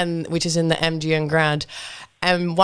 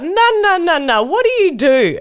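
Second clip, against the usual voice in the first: high, loud retold drunken pestering; hammed-up tipsy badgering; mock drunk insisting; 10 semitones higher, 4 dB louder than usual. Drunk friend quizzing the fighter.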